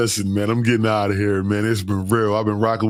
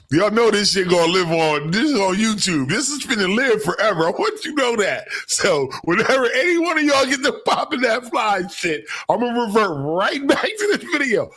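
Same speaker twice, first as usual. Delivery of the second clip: high, excited taunting; gleeful teasing; 12 semitones higher than usual. Real, not acted. Streamer warning viewers the clip lives forever, high gleeful taunt. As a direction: crow over them with glee.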